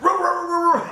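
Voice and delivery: rapid loud, high dog barks; imitated big-dog barking. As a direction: bark like an old dog sounding huge. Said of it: "He sounds like he's ten times bigger".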